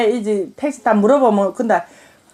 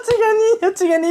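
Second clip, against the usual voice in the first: high pretend wail; mock mourning; 8 semitones higher than usual. Mother acts out hearing of a death, mock crying lament. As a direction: lament helplessly in mock grief. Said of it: "이러지".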